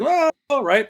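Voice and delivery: high, meek little okay; meek mock reply; sheepish. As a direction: back down awkwardly. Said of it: "And you're like".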